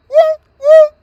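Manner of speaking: short high siren whoops; high "woo, woo" like a police car; police siren imitation. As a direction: mimic a siren with two quick woos.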